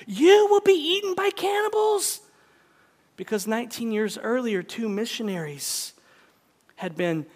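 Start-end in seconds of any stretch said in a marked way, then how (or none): 0.0-2.2 high-pitched outburst, sharply raised; exploding in alarm. Retold or imitated. Mister Dixon warning the departing missionary about cannibals, exploding. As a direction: burst out with a horrified warning, pitched way up. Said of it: "exploded at him and said".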